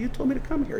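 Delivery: high-pitched, mock-indignant reply; Elijah protesting. Elijah answers God, high and defensive. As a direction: pitch it up like a prophet caught out.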